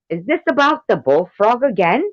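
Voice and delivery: high, loud mock English accent; hammed-up English voice, pitched high. Reading Tank's comment aloud, in an English accent. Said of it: "do you as a English person".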